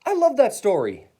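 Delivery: high, loud delight; excited exclaiming. A narrator's reading. Mary interrupts the teacher's reading, excited high exclamation. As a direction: burst out with high enthusiasm. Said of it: "Mary exclaimed".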